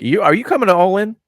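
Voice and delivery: normal talking voice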